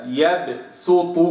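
يبسط with bounced ب, slow; slow Quranic يبسط; qalqala on baa. Shown as a demonstration. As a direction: let the ب in يبسط echo. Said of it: "هكذا".

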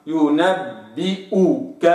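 slow ينبئك with ب kasra kept; slow recitation; full kasra. Shown as a demonstration. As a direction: complete the i in ينبئك. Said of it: "هكذا".

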